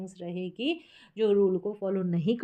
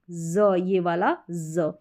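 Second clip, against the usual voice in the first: normal talking voice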